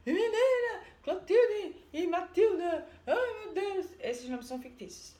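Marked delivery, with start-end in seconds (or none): 0.1-3.9 high, loud desperate cry for her sisters; panicked yell. Retold or imitated. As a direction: shriek the names in panic. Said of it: "Começa a gritar pras irmãs". (gritando desesperada pelas irmãs)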